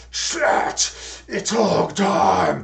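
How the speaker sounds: slow, loud rasping wrestler bellow; rally the crowd like a pro wrestler; heroic rallying shout. Pretend. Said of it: "gruff pro wrestler voice".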